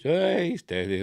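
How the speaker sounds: sudden high "Hey"; jokey startled warning; startled exclamation. Real, not acted. Reacting to nudity on screen.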